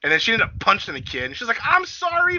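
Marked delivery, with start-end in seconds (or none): 1.6-2.4 high mock apology. Quoted apology.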